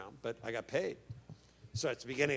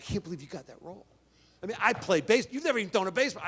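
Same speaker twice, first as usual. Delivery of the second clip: rising to a higher, louder retold complaint; mock indignation; 7 semitones higher, 6 dB louder, faster than usual. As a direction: act out the jealous outburst at a friend. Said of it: "And I'm pissed".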